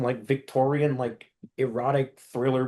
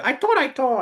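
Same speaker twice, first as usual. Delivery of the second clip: high squeaky cartoon bird voice, much higher; Tweety voice; 13 semitones higher, 5 dB louder than usual. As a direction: squeak it like a tiny cartoon canary. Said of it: "Tweety's like".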